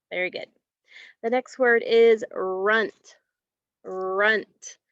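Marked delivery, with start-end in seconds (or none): none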